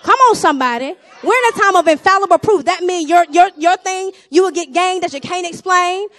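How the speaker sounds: loud, high, rising preacher's shout; preaching at full force. Acted. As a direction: drive it home to the congregation. Preaching climax.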